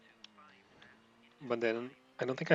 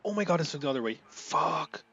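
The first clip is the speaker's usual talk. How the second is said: loud and high, cursing at a wrong turn; frustrated outburst; 5 semitones higher, 4 dB louder than usual. Real, not acted. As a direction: blurt it out in sudden frustration.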